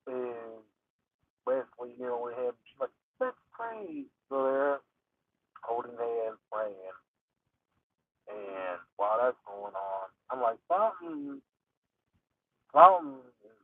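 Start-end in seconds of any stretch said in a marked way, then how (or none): 3.2-4.1 put-on higher, soft girl voice; lightly voiced creepy daughter; daughter's voice. Daughter inviting prayer. 10.7-13.6 rising, then higher, louder alarm; mock-alarmed realization; suspicious. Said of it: "his own suspicious reaction".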